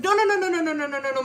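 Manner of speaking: rapid run of no's, starting high and loud, tumbling down; mock-panicked, hurried string of refusals. Friend heading off a visit from her, panicked refusal.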